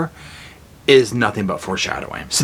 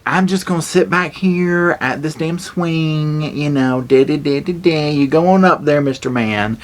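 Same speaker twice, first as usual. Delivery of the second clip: put-on sassy girl; 5 semitones higher than usual. Girl on the swing sending him to the door, sassy and high. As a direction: tease him sing-song from the swing. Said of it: "the girl's like".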